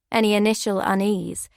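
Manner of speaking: usual speaking voice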